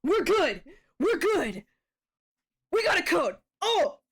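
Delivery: loud, high, thrilled yelling; excited shouting. Real, not acted. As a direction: shout it out in pure triumph. Streamer gets code, celebrates.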